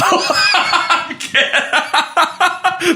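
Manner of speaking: drawn-out high laughter; can't stop laughing; long high laugh. Real, not acted. Laughing at dark joke.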